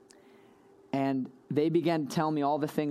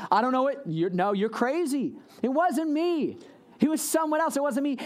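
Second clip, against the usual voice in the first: panicked denial; 9 semitones higher than usual. Retold or imitated. Peter panics denying to girl.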